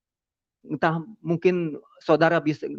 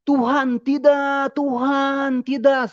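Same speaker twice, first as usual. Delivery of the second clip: high, loud cry out to God; pleading yell, pitched way up; retold shouting; 11 semitones higher, 4 dB louder, much slower than usual. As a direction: re-enact a desperate shouted plea, drawn out and high. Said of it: "sampai teriak-teriak saya".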